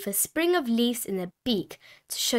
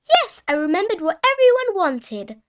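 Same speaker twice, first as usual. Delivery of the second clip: put-on high, louder child voice; excited little piglet; 8 semitones higher, 5 dB louder than usual. A narrator's reading. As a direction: answer eagerly as a small child. Peppa excitedly answers her mother.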